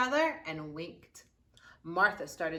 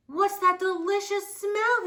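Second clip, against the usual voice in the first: louder, higher, slow and wondering; curious father; 12 semitones higher, 10 dB louder than usual. Acted. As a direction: sniff the air and ask with delight. Father enters kitchen.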